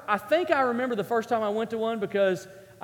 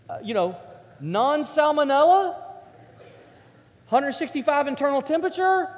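normal talking voice

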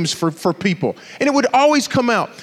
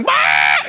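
shouted copy of a goofy laugh; mimicked laugh. Strangers across the room copying his laugh, mocking shout. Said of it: "People don't even know me just go".

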